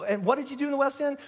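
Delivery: lightly acted high-voiced question; curious onlooker. Acted questioner asks again.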